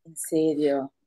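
hushed, shocked whisper. Real, not acted. Gossip partner whispering back in shock at the lawsuit news. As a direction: breathe it out in disbelief.

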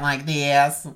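drawn-out Southern accent demo. Shown as a demonstration. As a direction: put on a slow Southern US accent. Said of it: "people in the South that have, like, an accent".

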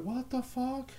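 high, soft, incredulous squeak; retold disbelief. Just saw family with meth heads, soft high disbelief. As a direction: let the disbelief go up high and quiet. Retelling reaction to the photo.

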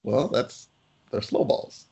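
ordinary delivery

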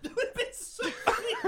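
laughing. Real, not acted. Laughing hard.

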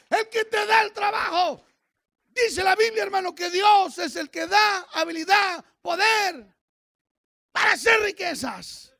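high, loud shouted exhortation; rally the church with shouted scripture; full-force preaching. Real, not acted. Preacher urging congregation not to fear, full-force preaching.

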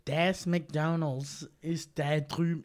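German words in a slow, drawn-out American accent; regular American accent. Shown as a demonstration. Asked to drop the German accent, slow American accent. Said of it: "do your regular accent, but speaking German".

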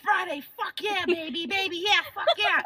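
high, loud Friday hype chant; TikTok guy impression. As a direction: build up like an excited party guy. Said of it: "this is how his TikTok will go".

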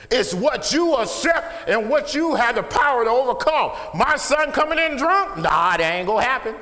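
high, loud pulpit shout; fervent shouted declaration; full-force preaching. Real, not acted. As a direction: hammer it home to the congregation.

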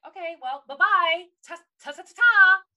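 high, loud singsong farewell; loud mock goodbye. Brushing off the characters who doubt her letter, loud singsong. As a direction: wave them off in a loud singsong. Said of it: "And it's like".

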